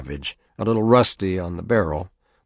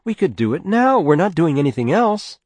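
high, louder voice of an exasperated wife; protesting mother; 9 semitones higher, 6 dB louder than usual. Acted. Mother arguing with father inside the house, high exasperated protest. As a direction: plead the point, pitched well up.

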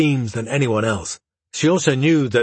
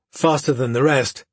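ordinary delivery

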